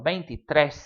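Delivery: veintitrés run together, ending stressed on trés; said as one word. Shown as a demonstration. Teacher showing the one-word form, veintitrés run together. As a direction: join veintitrés with no gaps and land on trés. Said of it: "frequently written as one word".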